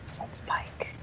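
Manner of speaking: a real whisper, barely audible; whispered prompt. Real, not acted. Prompting a fellow reader who missed a line, whispered. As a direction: whisper the cue to the other reader.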